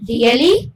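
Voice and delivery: Really up then down on ly; disbelief, up on rea, down on ly; rise-fall surprise. Shown as a demonstration. Teacher showing rise and fall of surprise. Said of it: "पहले really पे ऊपर गई और ली पे वापस से नीचे आ गई".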